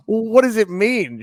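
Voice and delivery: high, loud retold confusion; comically puzzled outburst; mock bafflement. Retelling own baffled reaction to name.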